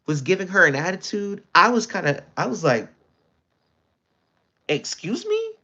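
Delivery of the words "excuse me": very high, thin, disbelieving squeak; shocked, offended excuse me; incredulous excuse me. Retold or imitated. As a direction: act as if you can't believe what you heard. Challenging a rude worker.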